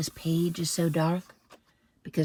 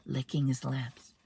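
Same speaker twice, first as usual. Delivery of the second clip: plain reading voice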